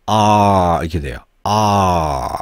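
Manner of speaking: long "아" gliding into an R as the tongue lifts. Shown as a demonstration. Teacher shows how the tongue lifts, long R-coloured "아". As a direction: stretch "아" and curl it toward R. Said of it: "아 하면서 혀를 들면".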